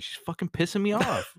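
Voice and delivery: high, put-on little boy grumble; imitating angry kid. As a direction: act out a kid who is fed up. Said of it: "he was like".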